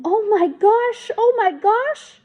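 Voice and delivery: high, loud, slow panicked exclaim; shaken driver. A narrator's reading. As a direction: gasp it out after nearly hitting a child. Driver after near accident.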